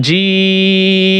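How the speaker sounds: জিম with the ee held very long and slow; long drawn vowel. Shown as a demonstration. Teacher stretches জিম slowly for students. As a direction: stretch জিম over three alif lengths. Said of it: "এভাবে তিন আলিফ টান হবে".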